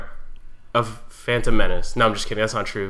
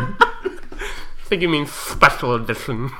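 mock correction; 2 semitones higher, slower than usual. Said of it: "we've got a speech impediment today".

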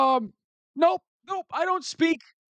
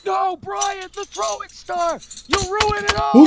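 panicked cry; 9 semitones higher than usual. Acted. Panicked cry at the throwing star.

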